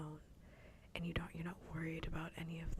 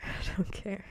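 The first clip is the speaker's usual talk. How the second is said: louder mock protest through laughter; laughing protest; 3 semitones higher, 6 dB louder than usual. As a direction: crack up while insisting. Laughing at own mock-annoyed quote.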